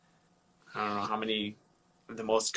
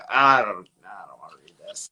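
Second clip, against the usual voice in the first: reluctant; 3 semitones lower, 8 dB louder than usual. Retold or imitated. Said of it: "so I was like".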